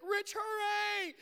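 high, drawn-out yell to hurry; friend yelling. Retold or imitated. Friend outside the bathroom door yells high and slow. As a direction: call through the door, urgent.

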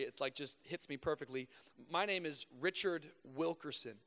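normal talking voice

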